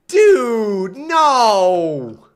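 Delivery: loud, drawn-out cry of protest; high, exasperated yell; dismayed shout. Real, not acted. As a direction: wail it in disbelief. Dismayed at game going wrong.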